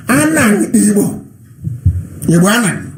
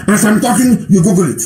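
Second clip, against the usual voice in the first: ordinary talk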